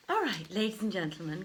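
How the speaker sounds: ordinary delivery, nothing marked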